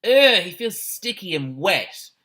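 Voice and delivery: disgusted exclamation. A narrator's reading. Boy touches the dyed horse, disgusted exclamation. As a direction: recoil in disgust at the sticky coat. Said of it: "gave an exclamation".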